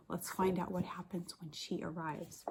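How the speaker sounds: hushed aside to the class; whisper. Real, not acted. Teacher whispering to her class before the story. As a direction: lean in and whisper the secret.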